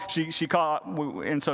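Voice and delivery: ordinary delivery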